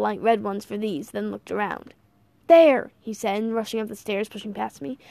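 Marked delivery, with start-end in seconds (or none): 2.5-2.9 loud sudden call. Acted. Old man spots the room.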